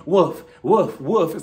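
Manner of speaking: spoken woofs imitating a dog; mock dog bark. Drew held back by security taunting her rival, mock barking. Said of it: "she's barking at her".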